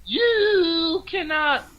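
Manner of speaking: high, slow, drawn-out scolding; mock outraged Cosby, stretching out every word. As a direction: lecture each word slowly in a high put-on voice. Cosby voice again.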